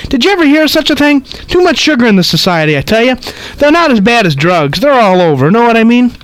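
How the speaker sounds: put-on high, chattering old lady; gossipy old woman rattling on. Reads old lady's lines.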